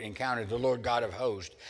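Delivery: normal talking voice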